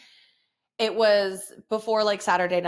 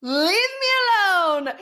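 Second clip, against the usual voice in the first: high, loud mock wail; hammed-up yell; 10 semitones higher, 6 dB louder than usual. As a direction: holler it like a mom at her wit's end. Yelling back at her kid.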